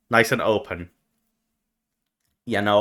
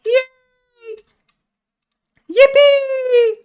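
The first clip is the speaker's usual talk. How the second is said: very high, drawn-out squeal; playful over-the-top high cheering; much slower than usual. Pretend. Cheers for the arriving viewer.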